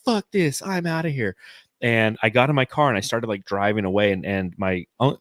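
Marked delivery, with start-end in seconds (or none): 0.1-1.4 retold angry storm-off. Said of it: "getting so pissed".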